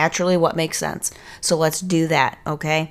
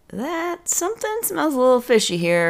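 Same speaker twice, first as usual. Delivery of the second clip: sarcastic; 8 semitones higher than usual. Real, not acted. Sarcastic aside.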